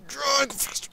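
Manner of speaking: high, sudden exasperated yell; exasperated outburst. Real, not acted. Gamer yelling at the bike, exasperated outburst. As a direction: blurt it out in frustration. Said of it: "That exasperated, um, uh, outburst".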